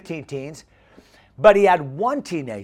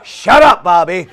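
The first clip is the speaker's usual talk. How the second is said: exasperated shout; 11 dB louder than usual. Acted. Youth pastor to pestering kid, exasperated shout. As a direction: cut the pest off with a shout.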